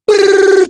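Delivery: very high rolled brrr; high-pitched celebratory brrr; excited trill. Real, not acted. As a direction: cap the hype with a high trill. Trilling to cheer on the hearts.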